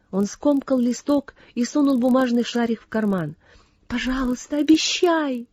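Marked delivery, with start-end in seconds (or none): 3.9-5.5 imploring, pitched up high; pleading. A narrator's reading. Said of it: "взмолилась Сесси".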